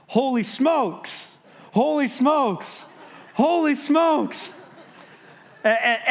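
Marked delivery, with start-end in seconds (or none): 0.1-4.5 high, slow, stretched-out exclamations rising each time; freaking out. Retold or imitated. Big coworker stunned after the collapse, slow high amazed cries. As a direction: build the astonishment with every repeat. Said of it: "And he's freaking out".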